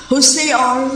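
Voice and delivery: ordinary talk, nothing marked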